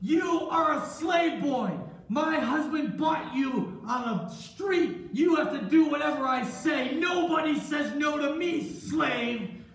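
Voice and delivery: loud, high, outraged scolding; imperious anger. Acted. Boss's wife berates her slave boy, imperious and angry. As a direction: pull rank hard, hammering each phrase.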